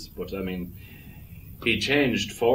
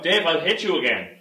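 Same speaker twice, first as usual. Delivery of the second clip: raised, high-pitched warning; scolding threat; 8 semitones higher, 3 dB louder than usual. Acted. Threatening Dave for getting it wrong, high scolding warning. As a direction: threaten him like an exasperated trainer.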